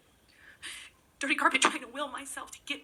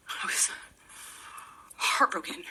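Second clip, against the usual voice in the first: breathy whisper, barely voiced; hushed confession; 3 semitones lower, slower than usual. Real, not acted. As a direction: let the last word fall to a whisper. Confessing the deepest hurt.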